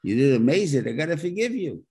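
loud, high, overdone praise; mock gushing praise. Mock lavish forgiver, then demand.

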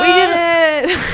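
high laughing victory cheer. Pretend. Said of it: "We're all just, like, cheering".

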